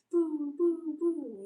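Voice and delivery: high, loud playful boo; mock booing. Streamer playfully booing a viewer, mock boo. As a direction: tease them with a mock boo.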